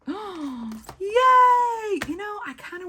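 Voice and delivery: delighted squeal of discovery; thrilled, high and loud; excited planning. Real, not acted. Finds the stamp she hoped for.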